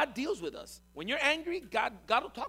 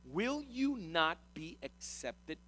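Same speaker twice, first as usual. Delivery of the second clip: very slow, deliberate challenge; God confronting, measured and weighty; much slower than usual. Acted. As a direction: spell it out word by word. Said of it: "And then he confronts him".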